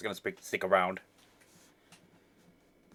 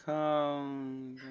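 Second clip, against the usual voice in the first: ordinary talk, nothing marked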